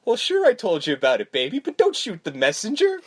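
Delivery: a light mock of a sleazy boyfriend; smug cheating boyfriend. Boyfriend brushing off his cheating to the girlfriend. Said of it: "And he says".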